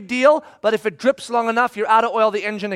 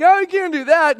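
higher, louder shocked cry; alarmed protest; 7 semitones higher, 5 dB louder than usual. Retold or imitated. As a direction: react in alarm to the threat. Shocked at boss threat.

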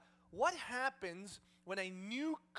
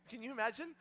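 ordinary talk

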